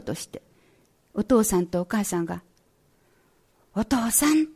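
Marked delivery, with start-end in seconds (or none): 3.7-4.5 higher-pitched call without extra volume. A narrator's reading. Parents calling to unresponsive father, mildly raised pitch. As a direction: call to him, pitch lifted. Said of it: "と声をかけました".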